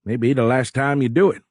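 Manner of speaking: high Cajun captain giving leave; high-pitched, hearty Cajun orders; thick Cajun accent. A narrator's reading. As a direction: send him off in broad Cajun. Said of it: "in a thick Cajun accent".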